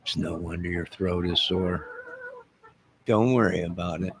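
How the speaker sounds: usual speaking voice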